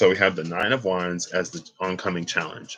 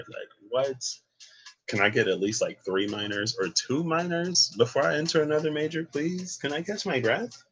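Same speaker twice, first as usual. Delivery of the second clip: high, pleading disbelief; exasperated plea; 8 semitones higher, 3 dB quieter than usual. Real, not acted. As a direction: beg for a break, half joking. Begging the cards for a break.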